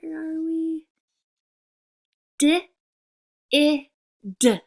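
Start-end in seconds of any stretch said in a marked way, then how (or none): 2.4-4.6 D, I, d called out loud and clear; sounded-out letters. Shown as a demonstration. Teacher calling out each letter sound of the word.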